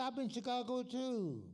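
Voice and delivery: put-on high, loud old man greeting a friend. Old friend hailing Sam in Jackson, high old man voice. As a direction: pitch it up like a chirpy old timer calling out.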